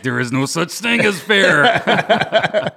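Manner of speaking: laughing retort. Retold or imitated. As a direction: laugh as you deliver the parental comeback.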